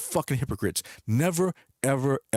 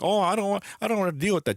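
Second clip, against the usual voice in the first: mocking whine; 8 semitones higher, faster than usual. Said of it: "mocking complainers".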